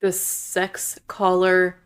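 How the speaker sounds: slow title announcement. Real, not acted. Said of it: "I'm putting you on the spot".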